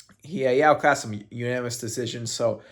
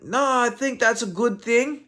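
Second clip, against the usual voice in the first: put-on Italian accent; 8 semitones higher than usual. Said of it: "I'll do this in a Marvin Vettori accent".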